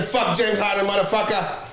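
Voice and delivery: lightly voiced Giannis taunting Harden; mock Giannis taunt. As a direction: jab at Harden in a put-on voice. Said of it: "I'm just playing".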